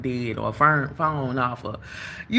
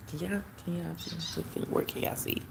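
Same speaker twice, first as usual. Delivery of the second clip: much softer, half-whispered question; hushed near the birds; 3 semitones higher, 10 dB quieter than usual. Real, not acted. Creeping up on the birdhouse to show viewers, hushed whisper. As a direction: hush so the birds stay put.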